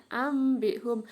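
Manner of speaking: AMBIHUM with a held m before b; iklab reading. Shown as a demonstration. Teacher repeating the example, m held. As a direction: hum through the m in AMBIHUM. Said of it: "dibaca mim sukun".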